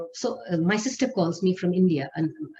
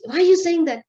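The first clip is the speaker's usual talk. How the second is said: imitating an upset sister, high and loud; high, loud, distressed question; tearful protest; 10 semitones higher, 7 dB louder than usual. Crying sister challenges the news about father, distressed. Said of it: "She started crying".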